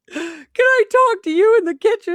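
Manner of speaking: high, loud tearful wail; mock ugly crying. Undercover officer begs older woman for a private talk, fake ugly crying. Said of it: "ugly crying".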